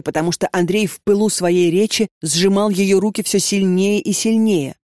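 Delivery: plain reading voice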